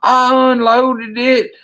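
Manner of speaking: high, drawn-out confession; panicked buddy. Retold or imitated. Buddy admitting the rifle is empty, panicked.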